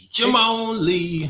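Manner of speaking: high, loud, drawn-out playful cry; hammed-up catchphrase call. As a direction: belt out a playful show-opening holler. High catchphrase call opening show.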